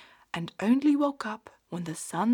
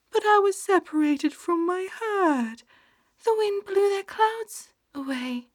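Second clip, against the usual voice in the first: high, tearful little sheep voice, lonely; a lost lamb telling her woes; sad little sheep; 11 semitones higher, 6 dB louder than usual. Acted. Sleep sheep Woolly speaks.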